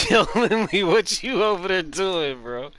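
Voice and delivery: loud, high, slow teasing call-out. Real, not acted. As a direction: yell it across at Lindley, stretched out. Calling out to teammate again.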